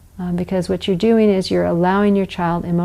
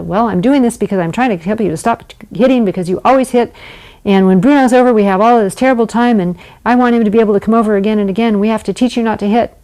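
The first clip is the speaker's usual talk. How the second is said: lecturing parent; 3 semitones higher, 4 dB louder, much faster than usual. Shown as a demonstration.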